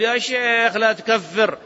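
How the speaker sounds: slow, put-on voice of a complaining critic; a slow mocking imitation of a critic; mock objector. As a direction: mimic the man scolding the sheikh. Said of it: "يقول".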